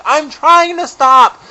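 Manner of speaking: loud, high, drawn-out frustrated shout; yelling back. Retold or imitated. Crashing skier to friend, slow high frustrated yell. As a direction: holler back helplessly, stretching the words. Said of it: "And I'm yelling back".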